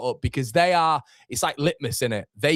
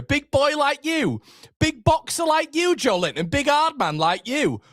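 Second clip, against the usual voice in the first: mocking taunt; 11 semitones higher than usual. Real, not acted. Fan taunting Joe Linton for falling around, high-pitched mocking. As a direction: jeer at the player in a raised pitch. Taunting a fallen player on screen.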